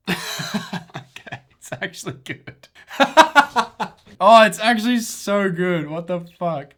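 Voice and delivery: high-pitched giggly delight, laughing through it; cracking up at how good it looks; real laughter bubbling over the words. Real, not acted. Delighted laughing at own fix.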